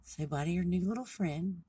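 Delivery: gently acted storybook mom calling time; lightly voiced mother deer. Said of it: "the deer's mom's like".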